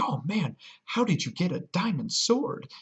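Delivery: high eager voice of an impressed player; curious bystander. Retold or imitated. Player asking the vlogger, in a high eager voice. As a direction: squeak it out like an awed onlooker. Bystander asks about the sword.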